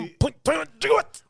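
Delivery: high, fast frantic mad scientist; manic Doc Brown. Retold or imitated. Friend quotes Doc Brown to his movie buddy, high and frantic. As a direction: blurt it like a panicked inventor.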